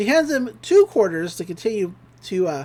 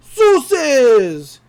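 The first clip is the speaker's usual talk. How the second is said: loud, high, drawn-out cheer; rallying shout; 4 semitones higher, 8 dB louder than usual. Retold or imitated. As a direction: belt out the answer like a hyped-up pupil. Pep talk answer shouted out.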